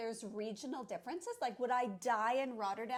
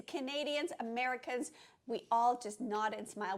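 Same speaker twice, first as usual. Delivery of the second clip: ordinary talk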